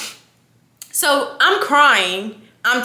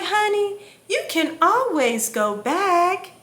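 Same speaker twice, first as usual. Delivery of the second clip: high, slow imitated reassurance; mimicked tender comfort, drawn out; sweet doctor; 7 semitones higher than usual. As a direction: soothe like a kindly doctor. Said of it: "she was so sweet".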